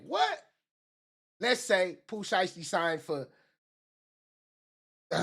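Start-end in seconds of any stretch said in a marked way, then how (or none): 0.1-0.4 mock shock. [name] reacting to news, mock high surprise.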